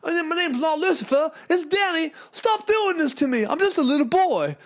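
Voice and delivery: put-on squeaky boy complaining to his dad; whiny little boy. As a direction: protest like a small child. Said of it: "Be like".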